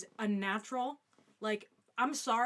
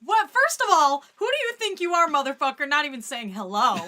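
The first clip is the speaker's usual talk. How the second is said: much louder, hammed-up fury; mock angry yell; 7 semitones higher, 17 dB louder than usual. Calling out a viewer's comment, hammed-up angry yell. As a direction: blow up at the chat for show.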